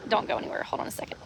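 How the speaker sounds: breathy hushed whisper. Real, not acted. To viewers before fetching the link, whispered. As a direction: whisper it as you slip away. Whispering while leaving camera.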